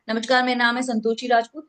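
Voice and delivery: fast, run-together "नमस्कार, मेरा नाम है Santoshi Rajput"; rushed introduction. Shown as a demonstration. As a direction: rattle through "Santoshi Rajput" without any pause. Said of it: "कोई नहीं सुनेगा". ("संतोषी राजपूत" जल्दी में, बिना रुके)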